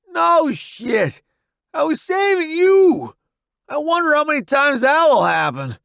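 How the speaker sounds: high loud wry yelling; sarcastic shout. Acted. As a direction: snap back through pain. Wounded soldier snapping back.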